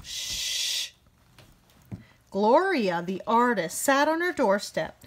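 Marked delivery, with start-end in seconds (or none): 0.0-0.9 soft hush; a real shush, no voice. Real, not acted. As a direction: hush the listener. Reader shushing a listener.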